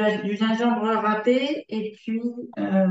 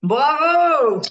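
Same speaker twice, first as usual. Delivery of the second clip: excited praise; 5 semitones higher, 6 dB louder than usual. Real, not acted. Teacher praises a correct answer.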